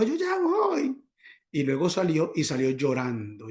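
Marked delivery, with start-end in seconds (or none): 0.0-1.0 high, drawn-out mock holler; imitated call-out. Shop woman calling to a customer, high imitated holler. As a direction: call out from a back room, pitched way up. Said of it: "gritó".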